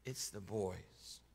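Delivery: slow, soft and calm; understated calm news. A narrator's reading. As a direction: say it slowly and evenly, holding back grief. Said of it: "I calmly said".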